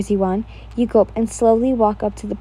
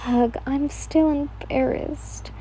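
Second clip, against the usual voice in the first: embarrassed; 4 semitones higher, 4 dB quieter, slower than usual. A narrator's reading. Embarrassed groan after speech.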